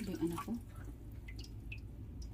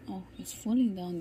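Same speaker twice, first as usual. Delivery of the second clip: normal talking voice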